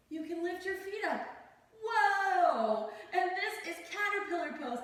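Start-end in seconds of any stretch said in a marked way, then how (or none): 1.8-2.9 loud, high mock surprise; playful whoa. Real, not acted. Lifting into caterpillar pose.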